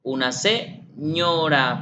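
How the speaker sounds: put-on very slow, drawn-out words for a child; slow modeling. Shown as a demonstration. Therapist modeling words slowly for a child. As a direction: spell each word out slowly.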